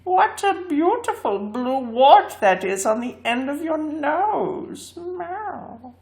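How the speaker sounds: high feline purr of false flattery; sweet mock compliment, high; glass cat voice. A narrator's reading. Glass cat flattering the man, high and sweet.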